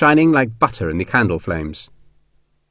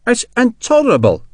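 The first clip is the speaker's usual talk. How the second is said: high-pitched indignant protest; 13 semitones higher than usual. A narrator's reading. Colonel embarrassed by sergeant's gift, protesting. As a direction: object with the pitch leaping up. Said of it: "McCandless protested".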